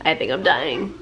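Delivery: hoarse sick voice, lost voice. Real, not acted. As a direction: speak like you have lost your voice. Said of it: "My voice is gone".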